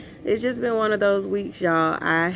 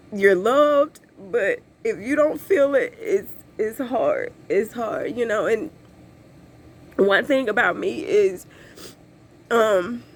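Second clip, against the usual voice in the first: ordinary delivery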